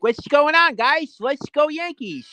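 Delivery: mock lisping voice, high. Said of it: "If I did, I'd be like".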